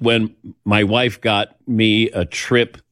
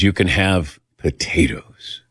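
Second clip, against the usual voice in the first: slow whispered permission; imitating the doctor's whisper; 4 semitones lower, slower than usual. Doctor whispering the diet change to the couple. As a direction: breathe it like a secret. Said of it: "he talked like this".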